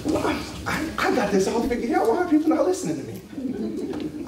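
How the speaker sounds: high, bewildered complaint; acted indignant self-righteous fretting; mock frustration. Voicing frustrated ones' inner thought.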